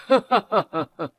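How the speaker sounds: mock her with a laugh; scornful laugh.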